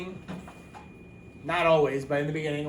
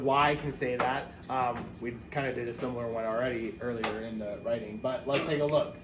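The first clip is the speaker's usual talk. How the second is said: usual speaking voice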